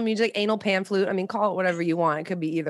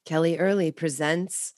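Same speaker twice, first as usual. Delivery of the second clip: slow, drawn-out announcer read with pauses; mock promo announcer; 4 semitones higher than usual. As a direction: present a spoof coming-attraction with grand pauses.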